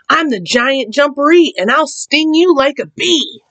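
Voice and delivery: high-pitched booming boast from the burrow; loud giant voice. A narrator's reading. Hidden Giant Jumperee warning Rabbit, loud voice. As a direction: put on a big scary voice, pitched high. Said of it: "he heard a loud voice".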